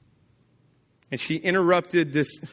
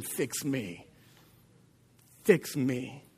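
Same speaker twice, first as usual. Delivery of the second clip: much softer, pleading Fix me; soft plea; 3 semitones lower, 6 dB quieter, much slower than usual. Acted. Broken woman begging Jesus, soft pleading. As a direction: beg quietly, almost breaking.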